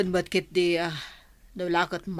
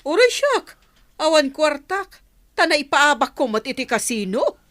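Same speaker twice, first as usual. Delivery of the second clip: high, loud, defensive outburst; heated and pitched far up; exasperated shout; 8 semitones higher, 6 dB louder than usual. Acted. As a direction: shout back that you are broke too. Quarrel over having no money.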